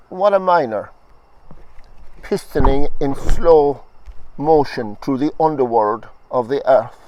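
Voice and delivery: deliberate recital, phrase by phrase with long breaths between; poetry reading. Acted. Poet reading Earthworm to the audience, measured recital. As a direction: savour each word of the list.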